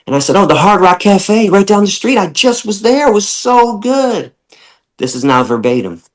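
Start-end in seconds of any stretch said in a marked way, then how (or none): 0.4-4.3 high, cheerful and proud, a lightly voiced young [name]; eager younger self. [name] telling Texans about Hard Rock, high and eager. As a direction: brag happily about the burger.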